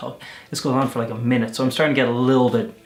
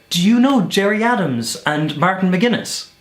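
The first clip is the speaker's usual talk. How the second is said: louder, higher, slow and eager; eager fan question; 7 semitones higher, 5 dB louder than usual. Retold or imitated. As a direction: ask like a thrilled history fan. Eager guard asks about politicians.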